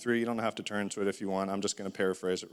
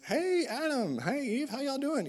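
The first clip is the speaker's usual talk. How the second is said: much higher, folksy serpent sliding up to them; sly snake voice. Acted. Talking snake greets Adam and Eve, high and folksy. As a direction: play the friendly snake sweet-talking the couple.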